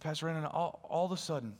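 hushed awe. Retold or imitated. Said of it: "He said".

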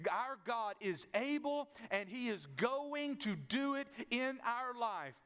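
measured preacher's build; preaching proclamation. Acted. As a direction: proclaim it to the congregation. Preaching emphatic declaration.

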